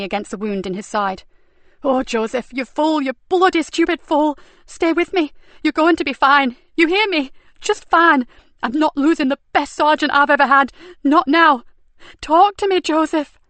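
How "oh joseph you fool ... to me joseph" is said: desperate pleading. Acted. Nicky pleading with dying Joseph.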